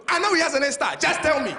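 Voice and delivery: imitating loud African dad. Dad pressing the scared teacher, loud mock dad voice. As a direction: lean on the teacher like dad does.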